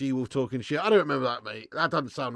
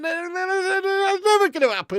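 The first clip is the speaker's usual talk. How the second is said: high, slow, disbelieving cry; scoffing at the very idea; incredulous exclamation; 7 dB louder, slower than usual. Real, not acted. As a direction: stretch it out in utter disbelief. Incredulous after mocking the club.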